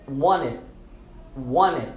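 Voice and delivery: "wanted" said like "wanned", no T; silent T. Shown as a demonstration. Teacher models "wanted" for learners, T silent. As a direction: drop the T of "wanted" after the N. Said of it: "nós vamos silenciar esse T".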